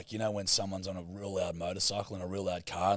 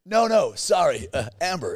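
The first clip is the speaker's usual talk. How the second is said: higher, louder mock American correcting; American accent; 8 semitones higher, 9 dB louder than usual. American friend correcting how Craig is said, louder and higher. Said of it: "the way Americans pronounce the name Craig".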